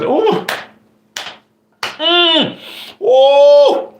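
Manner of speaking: very high "ooh" and "mm" squeals; re-enacted squeals of pleasure at the heat; spicy-food whoops. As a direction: whoop at the delicious burn. Re-enacts eating very hot food.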